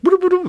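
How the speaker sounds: high-pitched nonsense syllables; imitated babble. Guide leading them through jungle, high imitated babble. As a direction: babble high like an unknown language.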